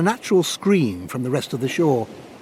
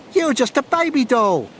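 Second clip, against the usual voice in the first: mocking; 12 semitones higher, 3 dB louder than usual. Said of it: "mocked Jean".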